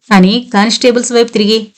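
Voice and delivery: ordinary delivery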